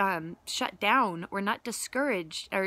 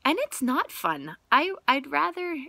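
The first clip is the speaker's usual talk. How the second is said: normal talking voice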